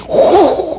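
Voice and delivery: high relieved whew. Retold or imitated. Relief after lady leaves.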